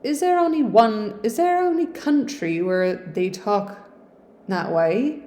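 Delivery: a touch of Hagrid's Yorkshire burr; Hagrid-ish Yorkshire. Acted. Reading Hannah's line in a light Yorkshire accent. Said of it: "It's giving Hagrid".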